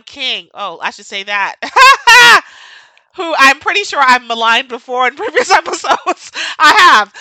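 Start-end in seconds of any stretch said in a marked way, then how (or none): 1.6-2.8 high, loud burst of laughter; loud high cackle. Real, not acted. Bursts out laughing at own remark. 3.1-7.1 louder, talking through laughter; laughing confession. Real, not acted. Laughing while admitting maligning.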